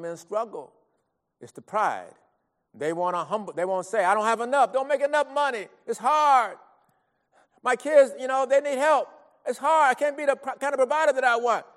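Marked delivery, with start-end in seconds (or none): 4.0-11.7 higher-pitched acted admission of hardship; mock confession, pitched up and pressing; struggling man's confession. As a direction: plead it as an overwhelmed provider. Voices struggling men admitting their hardship.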